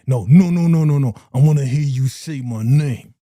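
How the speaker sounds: loud, insistent mock threat; hammed-up domineering command; acted menacing demand. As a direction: demand it loudly, playing the bully. Acting Kanan demanding his name.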